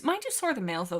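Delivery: ordinary talk, nothing marked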